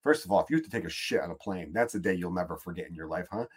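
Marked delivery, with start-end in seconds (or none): none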